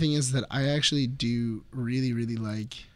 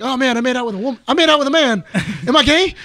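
loud, high mock panic; hammed-up horrified realization, pitch climbing; panicked movie character; 9 semitones higher, 8 dB louder, faster than usual. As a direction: imitate a man freaking out comically. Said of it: "and he's like".

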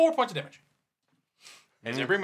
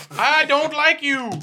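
high, slow, whiny cartoon kid; Cartman impression; 11 semitones higher, 7 dB louder, slower than usual. Player quotes Cartman killing the griefer, slow and high. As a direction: drag it out like a bratty cartoon kid. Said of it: "South Park, Cartman".

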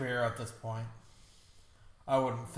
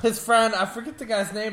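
ordinary talk, nothing marked

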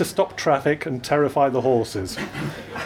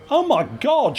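tipsy woman, high and shocked; 11 semitones higher than usual. Acted. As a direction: squeal it out high in disbelief. Drunk woman gasps in shock.

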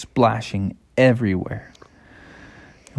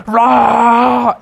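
high, loud "rah"; drawn-out cartoon roar; bear roar; 11 semitones higher, 5 dB louder than usual. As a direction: roar playfully as a charging cub. Bears chasing the people.

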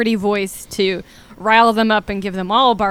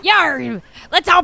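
hearty pirate, slightly raised; 6 semitones higher than usual. Acted. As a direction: growl it like a pirate. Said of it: "I'm doing a pirate voice".

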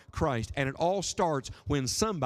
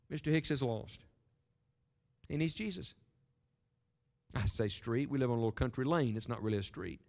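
hushed and soft; 5 semitones lower, 6 dB quieter than usual. Real, not acted. Hushed prayer request.

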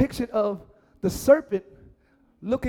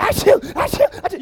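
mock sick woman; 11 semitones higher, 8 dB louder than usual. Sick person brushing it off.

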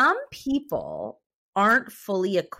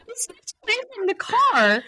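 laughing; 12 semitones higher than usual. Real, not acted. Laughing after her own punchline.

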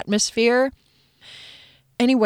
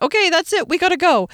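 much higher, chirpy announcement; impatient mom; 10 semitones higher, 4 dB louder, faster than usual. Retold or imitated. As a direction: cut the park visit short. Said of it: "I was like".

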